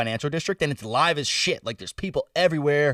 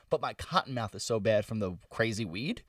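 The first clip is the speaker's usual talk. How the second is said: normal talking voice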